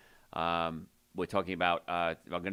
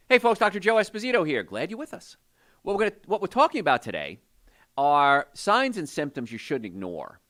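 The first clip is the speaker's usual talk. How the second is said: radio show intro; 6 semitones higher, 5 dB louder than usual. Acted. Host greeting his radio audience, upbeat announcer read. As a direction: open the segment like a radio host greeting listeners. Opens round two of show.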